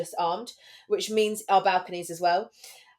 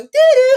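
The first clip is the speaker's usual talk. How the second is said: loud, high mimicked doo-doo; imitated buzzer; 16 dB louder than usual. Host imitating the gate buzzer at two A M, loud mimicked tone. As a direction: imitate a buzzer going off, loudly.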